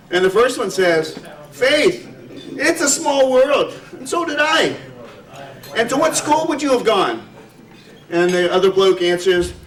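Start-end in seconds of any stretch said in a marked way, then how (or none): 1.5-7.2 excited Irish brogue, pitched well higher. Acted. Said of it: "I'll try to do a little Irish brogue".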